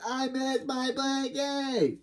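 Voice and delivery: high, slow, drawn-out whine; tantrum whine. Acted. Khloe missing her lost blankie, high whine. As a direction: whine it high, stretching the words.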